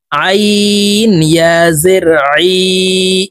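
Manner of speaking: "আইন" squeezed from mid-throat, slowly drawn out; pressed throat ain. Shown as a demonstration. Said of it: "হলকের মধ্যখান থেকে চাপ দিয়ে". ("আইন" গলার মাঝখান থেকে চাপ দিয়ে)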